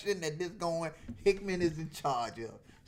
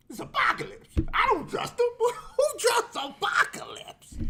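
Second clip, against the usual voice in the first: much higher and louder, hammed-up disbelief; comic high-pitched protest; mock outraged squeal; 7 dB louder than usual. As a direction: squeal it out like you're betrayed by a villain.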